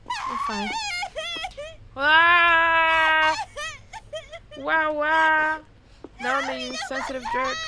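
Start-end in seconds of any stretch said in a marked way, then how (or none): 0.1-0.7 very high squeak of a crying voice; squeaky cry voice. Acted. Player voicing a tearful little ghost, high squeaky crying. 2.0-5.6 loud cartoon wah wah crying; loud mock wail. Player hamming up the heroine's tears, loud mock wailing.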